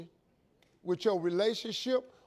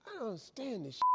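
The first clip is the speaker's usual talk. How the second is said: hushed grumble to himself; private, puzzled aside; muted grumbling; 10 dB quieter, faster than usual. Retold or imitated. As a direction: say it under the breath, much softer. Grumbling to himself in retold story.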